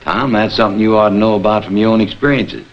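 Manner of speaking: usual speaking voice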